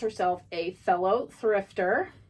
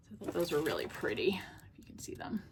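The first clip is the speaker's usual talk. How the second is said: whisper; 4 semitones lower, 10 dB quieter, faster than usual. Real, not acted. Whispering while showing earrings.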